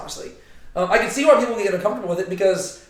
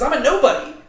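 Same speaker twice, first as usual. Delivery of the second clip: protesting nobody; 5 semitones higher, 4 dB louder than usual. Retold or imitated. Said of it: "He says".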